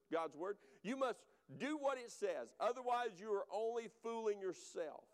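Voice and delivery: ordinary talk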